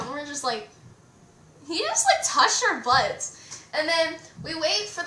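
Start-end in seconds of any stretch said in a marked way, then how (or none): 1.7-3.4 retold disbelief. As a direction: jump up high, then slow down in mock shock. Sisters' retold shock walking out.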